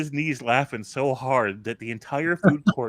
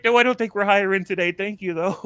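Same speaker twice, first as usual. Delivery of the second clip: amused refusal; 10 semitones higher, 3 dB louder than usual. Retold or imitated. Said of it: "he's like".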